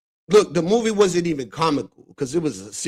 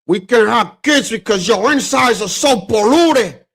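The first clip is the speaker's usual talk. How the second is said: loud, raised impression of Tony Montana's line; Al Pacino quote; 7 semitones higher, 8 dB louder than usual. Retelling Scarface to viewers, loud mock gangster voice. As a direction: belt it like the movie gangster.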